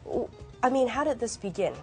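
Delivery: ordinary talk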